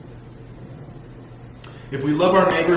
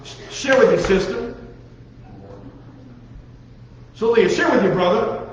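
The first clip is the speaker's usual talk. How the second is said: higher, louder mock mom telling the kids to share; 5 semitones higher, 3 dB louder than usual. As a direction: impersonate a nagging mother. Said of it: "I was trying to sound like your mom".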